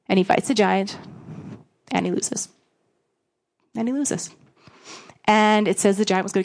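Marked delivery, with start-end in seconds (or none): 1.9-4.3 softer, dropped repeat for weight; hushed repetition. Acted. A preacher letting the congregation feel David's defeat, soft repeated. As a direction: let the loss sink in quietly. Sermon climax, repeated line.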